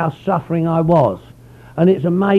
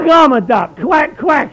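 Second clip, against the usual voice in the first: playful quack; 7 semitones higher, 7 dB louder, slower than usual. Retold or imitated. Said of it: "he said".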